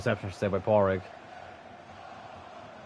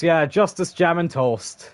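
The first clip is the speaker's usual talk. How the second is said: higher, louder catchphrase shout; imitating a fan channel host's celebration; Mark Goldbridge; 6 semitones higher, 3 dB louder, slower than usual. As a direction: belt out a football pundit's catchphrase. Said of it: "as Mark Goldbridge would say".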